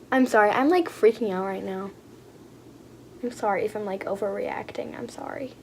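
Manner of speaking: soft, low, fading apology; sheepish apology. Real, not acted. Vlogger apologizing to viewers for overreacting, sheepish. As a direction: shrink back, voice dropping away softly.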